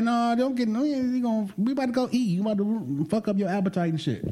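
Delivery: high, animated mock pleading; acted-out protesting. Acting out argument over a donut.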